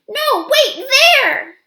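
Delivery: high, loud urgent call; Ruby redirects the elephants toward Kudzu; excited shout. Acted. Excited shout.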